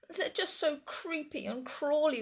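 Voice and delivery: high, whiny wail; a big dinosaur whimpering about spiders, pitched high. Acted. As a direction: wail like a scared child. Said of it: "wailed the Gigantosaurus".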